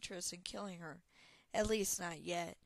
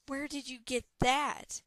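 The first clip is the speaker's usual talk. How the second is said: loud, higher startled question; urgent demand; 5 semitones higher, 9 dB louder than usual. Acted. Talon demands answer about pendant, loud.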